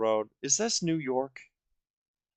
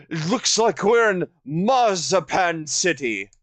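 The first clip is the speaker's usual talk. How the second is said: cartoon voice; 5 semitones higher, 4 dB louder than usual. Quoting cartoon after Chowder bit.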